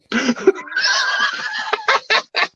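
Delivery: long wheezy high laugh; real laughter at your own joke. Real, not acted. Co-host cracks up over grandma's name, laughing.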